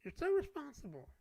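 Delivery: high mocking singsong; mocking sarcastic. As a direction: sneer it in a high voice. Repeats tease, mocking voice.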